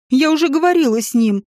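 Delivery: plain reading voice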